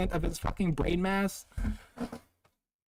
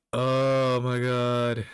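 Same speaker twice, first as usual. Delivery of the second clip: drawn-out exasperated groan; 2 semitones lower, 5 dB louder, much slower than usual. Real, not acted. Streamer exasperated by chat.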